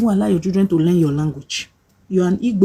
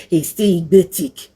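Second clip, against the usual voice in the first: lightly voiced sneering critic; a hint of a scornful heckler; mocking critics. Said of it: "you say".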